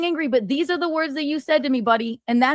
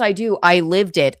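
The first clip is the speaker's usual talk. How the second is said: ordinary delivery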